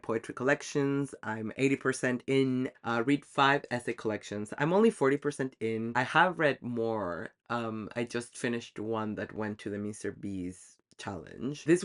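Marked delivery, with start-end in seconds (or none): none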